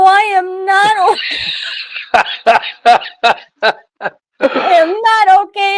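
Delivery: high, loud, sobbing cry of I am not okay; crying, half laughing. Retold or imitated. Stranded driver sobbing to a stranger after the crash, crying out. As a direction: wail it through tears and a laugh. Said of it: "and I'm crying".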